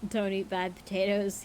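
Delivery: usual speaking voice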